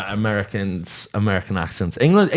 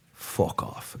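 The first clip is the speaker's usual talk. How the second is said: whispered; 13 dB quieter than usual. Retold or imitated. People reacting to his Americanisms.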